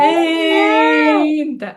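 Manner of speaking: a drawn-out, loud pretend wail for the children; mock wail. Shown as a demonstration. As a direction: show them how a child cries, stretching the sound. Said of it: "ಹೇಗೆ CRY ಮಾಡುದು?".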